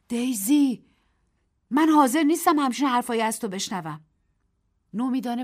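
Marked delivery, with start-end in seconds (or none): 0.1-4.0 louder, firm refusal; indignant. A narrator's reading. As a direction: refuse to hear it. Narrator rejects her words. (با اعتراض و قاطع)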